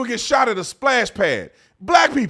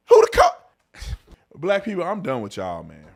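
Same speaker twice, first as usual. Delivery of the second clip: ordinary talk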